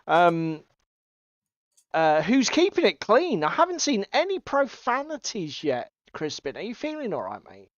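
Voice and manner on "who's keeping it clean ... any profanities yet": ordinary talk, nothing marked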